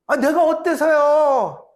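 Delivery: slow, loud, high defiant whine; stubborn retort. Acted. Pastor mocking a stubborn believer's defiant reply. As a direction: sulk back at God like a stubborn child.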